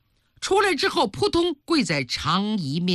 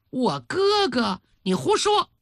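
shocked disbelief; 6 semitones higher than usual. Acted. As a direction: recoil in disbelief, pitch leaping up. Queen shocked by servant's revelation.

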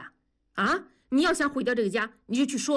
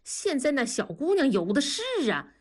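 higher, urgent coaxing; worked-up; 4 semitones higher than usual. Acted. Buddy pleads with his friend, voice rising higher. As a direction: press your buddy hard, voice climbing. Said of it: "狠拍大腿说".

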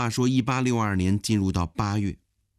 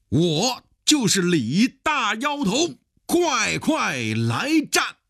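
high, slow, drawn-out warrior's challenge; battle cry; 13 semitones higher, 3 dB louder, slower than usual. Acted. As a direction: call out the challenge theatrically, stretching each word. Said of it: "冲到阵前是大声呼喊". (阵前高声呼喊叫阵，拖长字音)

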